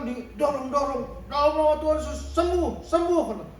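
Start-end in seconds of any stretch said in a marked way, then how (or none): 1.3-3.2 mock fervent prayer. Preacher retelling a student praying over him, mock fervent. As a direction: ham up the praying student calling for healing.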